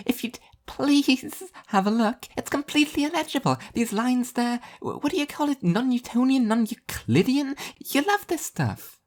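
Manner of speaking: high, sing-song airy angel; bubbly and blissfully oblivious; cheery angel voice. A narrator's reading. Spaced-out angel teasing the sleepy devil, high and chirpy.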